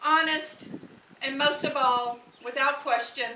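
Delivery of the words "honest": ordinary talk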